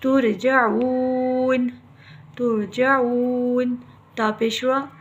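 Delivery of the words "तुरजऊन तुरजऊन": तुरजऊन very slow, ū held; long ū recitation. Shown as a demonstration. Teacher reciting, slow drawn तुरजऊन. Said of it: "ऐसे ही".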